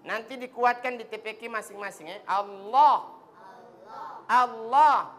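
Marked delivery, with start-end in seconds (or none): 2.3-5.1 Allah louder, clear lam; Allah with the tongue kept in; correct Allah. Shown as a demonstration. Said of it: "Jangan kasih keluar itu lidah". (Allah dengan lidah tidak keluar)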